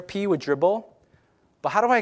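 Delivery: usual speaking voice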